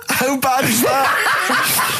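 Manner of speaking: cracking up while repeating it; a real laugh breaking the line. Real, not acted. Laughing at the grim twist.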